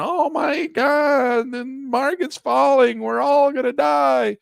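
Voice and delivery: high, slow hammed-up wail of doom; mock panicking investor. Mocking viewers who panic as the market falls, high hammed-up wail. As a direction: play the hysterical investor who thinks it's the end. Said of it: "yelling and screaming".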